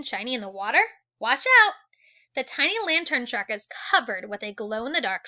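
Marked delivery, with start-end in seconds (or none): 1.2-1.7 warning shout. Acted. Warning call in a read-aloud.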